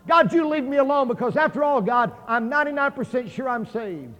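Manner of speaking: high, loud protest to God; retold inner scream. Man telling God to leave him alone, acted-out scream. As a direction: shout it at God, acting out a scream held inside. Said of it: "I screamed on the inside of myself".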